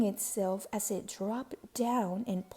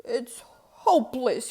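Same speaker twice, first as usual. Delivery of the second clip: despairing cry, voice cracking; cried; 5 dB louder than usual. A narrator's reading. Baker despairs over his dough, crying. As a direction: give up in tears over the dough. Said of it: "he cried".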